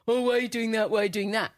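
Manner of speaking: grumpy husband. Retold or imitated. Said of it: "old grumpy guts going".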